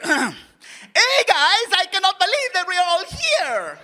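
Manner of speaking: put-on loud-hearted, high, over-the-top Mexican accent; hammed-up, high-pitched Mexican accent; exaggerated Mexican accent. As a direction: double the Mexican accent and the energy. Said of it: "more energy and double the spice".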